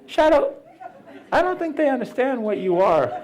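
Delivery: high-pitched, gingerly check-in; mock concern. Speaker checking on the shadow, high mock concern. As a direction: ask high, as if coaxing someone hurt.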